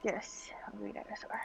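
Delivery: whispered hungry dinosaur. Pretend. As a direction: breathe it out unvoiced. Whispered echo of the phrase.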